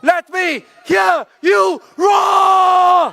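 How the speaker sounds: high, slow, loud crowd call; rousing roar. Real, not acted. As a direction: stretch it out to rouse the crowd.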